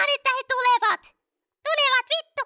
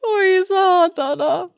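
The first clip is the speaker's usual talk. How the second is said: mock whimper; 8 semitones lower, much slower than usual. Slow crying voice.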